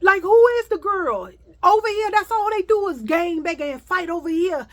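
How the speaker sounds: high, loud shocked passengers. Retold or imitated. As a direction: gasp it out with concern. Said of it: "They was like".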